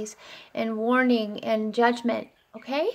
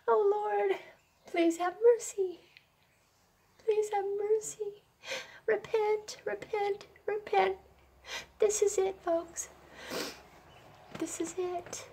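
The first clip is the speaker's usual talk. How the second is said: higher and softer, near tears; tearful pleading prayer; 9 semitones higher, 5 dB quieter than usual. Real, not acted. Believer pleading with God for sinners, tearful prayer. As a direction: beg God for mercy through tears. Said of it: "I'm gonna cry again".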